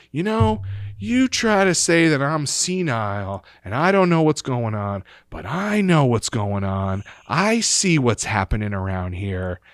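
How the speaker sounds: imitated indignant grandma, loud with swooping high pitch; defiant grandma. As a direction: have the last word, loudly and defiantly, as grandma. Grandmother defiantly ends argument, retold.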